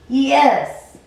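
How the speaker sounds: sudden high loud cry of joy; joyful shout. Acted. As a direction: burst out with a triumphant yes. Character exults mid flight.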